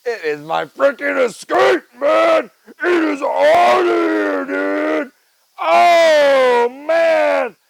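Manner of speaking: triumphant shout about his Escape; excited shout. Real, not acted. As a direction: crow with joy about the car. Celebrating the fixed Ford Escape.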